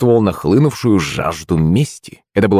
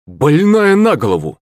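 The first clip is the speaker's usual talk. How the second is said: angry yell; 7 semitones higher than usual. A narrator's reading. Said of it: "злобно выкрикнул Шепелев".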